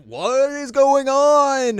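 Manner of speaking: hammy stunned outcry; loud slow disbelief. Retold or imitated. Everyone reacting to the bird being chopped, loud slow shock.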